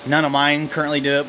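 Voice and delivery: normal talking voice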